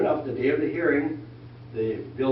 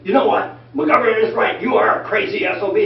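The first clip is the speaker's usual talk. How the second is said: higher and louder mock telling-off; mock outburst; 7 semitones higher, 7 dB louder than usual. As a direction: ham up the caller's rant. Retold angry outburst.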